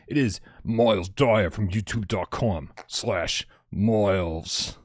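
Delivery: put-on slow, drawn-out English accent on the co-host's name. Host introducing co-host Miles with a slow, mocking English accent. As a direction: announce him in a plummy English accent. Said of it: "use an English accent while saying your name".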